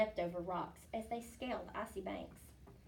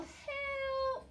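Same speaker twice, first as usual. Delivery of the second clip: 1 howl. A narrator's reading. Wolfling howling for help.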